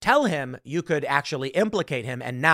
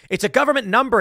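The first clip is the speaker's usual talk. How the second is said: louder and higher, offended insistence; almost indignant; 8 semitones higher, 6 dB louder, faster than usual. Retold or imitated. Fake agent to doubting victim, indignant. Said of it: "he said, almost indignant".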